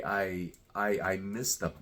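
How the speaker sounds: normal talking voice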